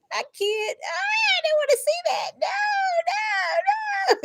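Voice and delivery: squealing, half-crying laugh; laughing scream. Real, not acted. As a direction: shriek through laughter at what you saw. Shocked by a vision, laughing.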